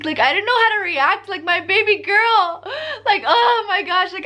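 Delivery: put-on choked up about her baby girl; tearful. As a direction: near tears, laughing and crying at once. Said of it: "I literally started crying".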